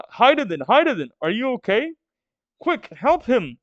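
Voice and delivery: high, urgent cries for a hurt friend; panicked kid. Acted. A friend calling to the injured Hydaden, high panicked cry. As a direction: call his name in a high panic.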